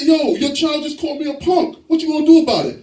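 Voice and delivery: high-pitched outraged demand; mock-offended, worked-up protest; indignant complaint. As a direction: confront the mother about the child. Complains to the child's mother.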